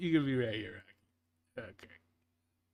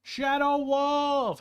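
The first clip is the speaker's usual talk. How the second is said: calls the name out high and loud, drawn out slowly; excited greeting; 10 semitones higher, 4 dB louder, much slower than usual. Real, not acted. Greeting a viewer who just joined, shouted high and slow.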